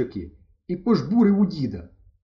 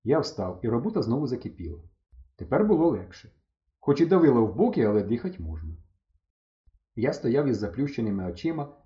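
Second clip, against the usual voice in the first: ordinary delivery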